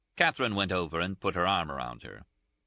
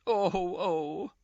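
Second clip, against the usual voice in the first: high, sobbing oh, oh; 13 semitones higher than usual. A narrator's reading. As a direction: let out two tearful sobs. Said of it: "she sobbed".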